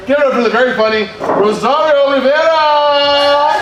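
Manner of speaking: announcer intro. Acted. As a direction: hype up the crowd for the next comic. Host introduces next comic.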